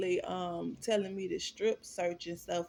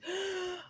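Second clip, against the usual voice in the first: sharp high gasp of shock; imitated startled gasp; 13 semitones higher than usual. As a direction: gasp like you can't believe it. Mimics his shocked face.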